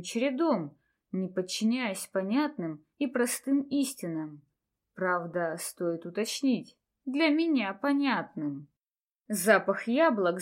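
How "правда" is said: ordinary delivery, nothing marked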